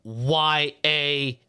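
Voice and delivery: letters spelled out slowly; spelling it out. Shown as a demonstration.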